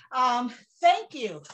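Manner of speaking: usual speaking voice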